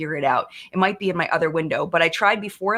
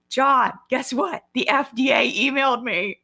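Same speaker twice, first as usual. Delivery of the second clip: excited; 6 semitones higher than usual. Retold or imitated. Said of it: "I was freaking out".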